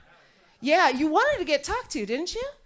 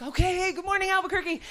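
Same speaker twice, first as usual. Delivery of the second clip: pitched-up, overeager TV forecast voice; nervous weatherman; 3 semitones higher than usual. Retold or imitated. Frantic Albuquerque weatherman doing his forecast on screen, high and jittery. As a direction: rattle off the weather like a jittery morning anchor. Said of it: "he's like".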